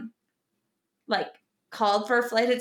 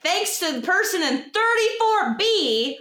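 high and slow, sarcastic captain's call-out; snarky mock announcement; 7 semitones higher than usual. Imagining the pilot blaming her seat over the PA, slow and sarcastic. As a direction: drag it out, shaming the passenger.

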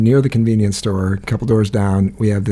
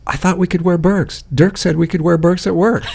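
higher mock-protesting voice, laughing through it; new girl protesting the rule, voice pitched up with a laugh; 7 semitones higher than usual. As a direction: play the new girl's protest a little higher.

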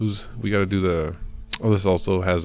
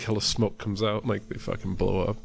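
usual speaking voice

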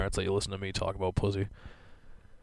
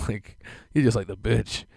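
usual speaking voice

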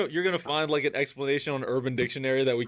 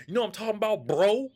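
raised pitch, punching BRO at the end like an expletive; super-hard BRO; 7 semitones higher, faster than usual. Retold or imitated. Imitating a Chicago Uber driver, super-hard bro. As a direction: hit "bro" super hard, almost cursing it. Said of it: "he said the word bro super hard".

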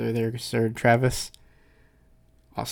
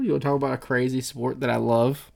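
ordinary delivery

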